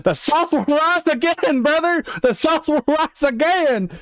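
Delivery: mock southern accent. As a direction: play a proud Southern good ol' boy, Southern accent. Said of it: "having a southern accent".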